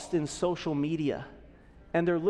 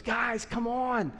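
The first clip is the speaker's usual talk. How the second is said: higher, urgent pleading, like a coach urging friends on; Paul pleading; 5 semitones higher than usual. Retold or imitated. Paul urging believers not to waste time, pleading higher. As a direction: beg the believers to get moving, higher and urgent. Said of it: "I picture Paul here almost pleading".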